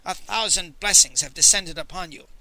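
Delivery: ordinary delivery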